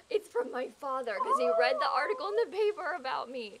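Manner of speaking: loud, hammed-up delight; gushing excitement. Player bragging about a letter from father, loud and excited. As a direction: gush proudly, loud and breathless with joy.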